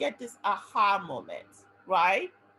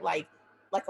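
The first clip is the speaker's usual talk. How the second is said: usual speaking voice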